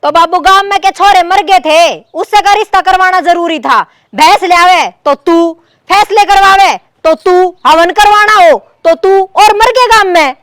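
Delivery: loud, high-pitched tirade at father-in-law; angry shouting. Acted. Daughter-in-law berates father-in-law in quarrel. (गुस्से में चिल्लाकर ताने)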